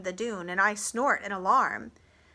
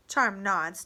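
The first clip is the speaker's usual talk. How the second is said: ordinary delivery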